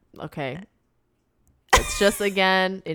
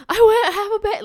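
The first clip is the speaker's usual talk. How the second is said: higher, loud, breaking into mock tears; mock sobbing confession; 14 semitones higher, 10 dB louder, faster than usual. Zed blurting his guilt, hammed-up crying. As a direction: blubber out a confession.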